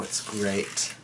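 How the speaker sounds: plain reading voice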